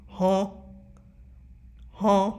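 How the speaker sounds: short, repeated cat-like "huh"; TikTok cat impression. Showing viewers the TikTok cat, mimicking its "huh". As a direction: imitate a puzzled talking cat. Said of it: "that cat, that TikTok".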